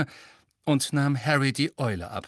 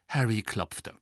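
ordinary delivery

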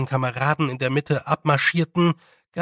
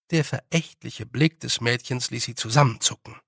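ordinary delivery

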